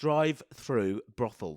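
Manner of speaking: careful tongue-twister. Shown as a demonstration. Co-host testing a hard phrase, slow and careful. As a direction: sound out "Drive-through brothels" slowly, word by word. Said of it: "That's quite hard to say".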